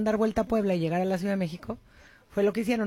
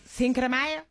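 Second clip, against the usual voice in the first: put-on American accent; 3 semitones higher than usual. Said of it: "para los gringos".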